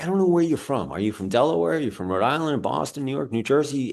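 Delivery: high quizzical voice of puzzled strangers; curious strangers. Retold or imitated. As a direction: play the baffled asker, pitched high. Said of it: "People are like".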